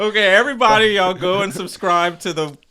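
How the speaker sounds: loud, high announcer voice to the audience; host callout to viewers. Acted. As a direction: pitch it like a show host calling out. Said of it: "addresses viewers as host".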